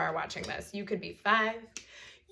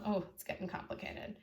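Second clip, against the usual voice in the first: muttered aside; 8 dB quieter than usual. Real, not acted. Aside while drawing.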